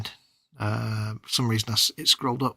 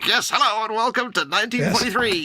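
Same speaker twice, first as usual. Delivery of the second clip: newsreel announcer; 6 semitones higher, 4 dB louder than usual. Pretend. Mock 1940s newsreel opening.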